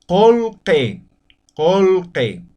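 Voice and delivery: colque said slowly as "kolke", u sounding like o and i like e; Quechua-style vowel demo. Shown as a demonstration. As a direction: model colque for the class as "kol-ke", open vowels. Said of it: "vamos a pronunciar como".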